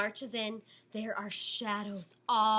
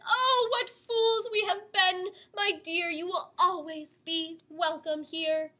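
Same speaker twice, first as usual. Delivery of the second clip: queen; 7 semitones higher, 4 dB louder than usual. A narrator's reading. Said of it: "the queen says".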